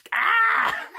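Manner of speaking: high yelping dinosaur scream; raptor scream. Acted. Dad voicing Rick the raptor's high scream. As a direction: shriek it high and loud.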